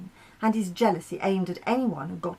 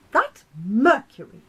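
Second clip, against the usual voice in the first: louder, higher, fed-up cry; exasperated outburst; 4 semitones higher, 8 dB louder than usual. Acted. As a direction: spit out the name in frustration. Moon complaining about Mercury.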